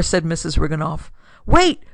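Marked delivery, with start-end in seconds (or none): 1.5-1.8 high, loud plea to stop; urgent shout. Acted. Acted desperate attempt to stop attack.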